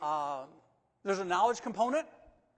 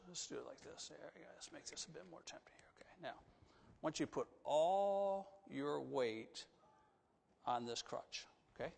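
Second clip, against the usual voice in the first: whisper; 3 semitones lower, 8 dB quieter than usual. Real, not acted. Coaching boy on stage privately.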